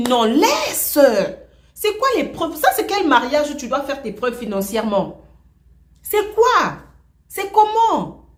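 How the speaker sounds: the boyfriend loudly acting offended; indignant outcry. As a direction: fire back questions in mock outrage. Scammer voiced protesting loudly.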